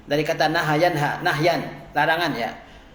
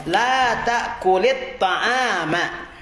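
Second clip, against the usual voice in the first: slow model reading; 2 semitones higher, much slower than usual. Shown as a demonstration. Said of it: "teacher models slow phrase".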